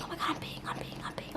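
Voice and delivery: urgent whispered confession, acted out; retold whisper. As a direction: whisper it as if secretly telling a friend. Retold panicked aside while peeing.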